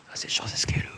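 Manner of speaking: ordinary delivery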